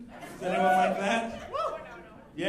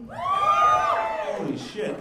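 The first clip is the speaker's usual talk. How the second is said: big, much higher and slow exclaim of disbelief; shocked outburst; 5 dB louder, much slower than usual. Real, not acted. Comic shocked by the crowd's answer, high disbelieving yelp. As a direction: yelp it out, stunned, high and stretched. Nobody answers coffee question.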